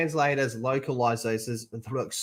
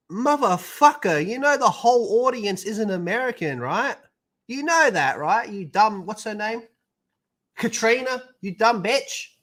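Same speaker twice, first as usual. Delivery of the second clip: mock rant; 8 semitones higher than usual. Imagined rant at the translator.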